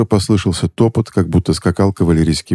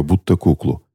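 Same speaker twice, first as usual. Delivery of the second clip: usual speaking voice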